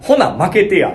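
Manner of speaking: pushy Kansai haggling, high and loud; わざと強い関西弁. Retold or imitated. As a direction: demonstrate forceful bargaining in Kansai dialect. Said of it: "もうほんまにこの言い方でわざと言ってた". (強めの関西弁で値切る)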